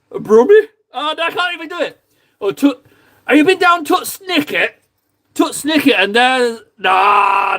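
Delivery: high, strained Brummie accent attempt; bad Birmingham accent attempt. English streamer trying a Birmingham accent for chat. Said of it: "That was awful".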